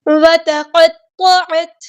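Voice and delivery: وتقطعت slow, ق heavy, ت light; careful reading. Shown as a demonstration. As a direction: read each letter carefully. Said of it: "اس لفظ کو بھی دھیان سے پڑھنا ہے". (آہستہ، قاف موٹا، تا باریک)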